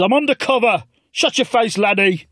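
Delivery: high put-on old man snapping; mock Corbyn. Corbyn hushes the narrator in a high put-on voice. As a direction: bark it like a gruff Scot.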